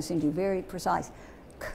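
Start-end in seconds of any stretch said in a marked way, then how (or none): 1.6-1.7 "K" as a bare consonant sound, not the letter name. Shown as a demonstration. Teacher letting the sounds out for spelling, "K" as bare sound. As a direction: release just the sound of "K". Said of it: "We're gonna let the sounds out".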